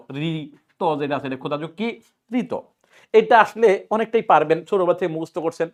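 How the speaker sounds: ordinary delivery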